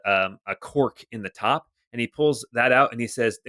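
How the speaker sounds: normal talking voice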